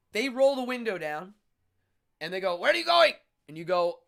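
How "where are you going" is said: mock cabbie yelling out, high and loud; cabbie's shout. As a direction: call out sharply to a passenger, imitating a driver. Acting out cab driver's shouted question.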